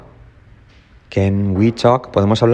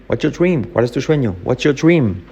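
usual speaking voice

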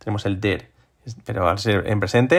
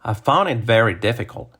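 connected example sentence; natural-speed model sentence. Shown as a demonstration. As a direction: say it in one smooth run.

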